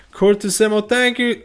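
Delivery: high, loud, excited thanks; excited shout-out. Real, not acted. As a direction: burst out with a happy shout-out.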